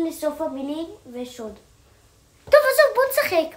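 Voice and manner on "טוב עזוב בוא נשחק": high loud exasperation; exasperated. Real, not acted. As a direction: throw up your hands.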